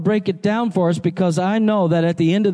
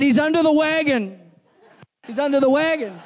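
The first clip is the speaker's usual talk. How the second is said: laughing punchline; 8 semitones higher than usual. Real, not acted.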